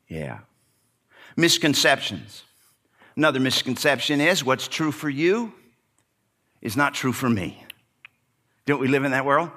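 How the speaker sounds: usual speaking voice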